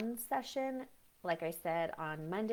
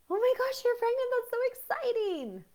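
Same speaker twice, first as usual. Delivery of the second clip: shrieking high and loud with delight; over-the-top excitement; 9 dB louder than usual. Shown as a demonstration. Congratulating a pregnant friend, shrieking high and loud with joy. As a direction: squeal the news like you can't contain yourself. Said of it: "which is more abnormal?".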